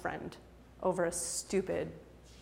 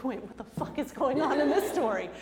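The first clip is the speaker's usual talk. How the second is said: listener's confusion; 5 semitones higher than usual. Pretend. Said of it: "You're like".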